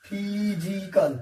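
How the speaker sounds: फिजिकल stretched very slowly and clearly; careful pronunciation. Shown as a demonstration. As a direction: model फिजिकल slowly for the class. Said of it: "teacher models the word".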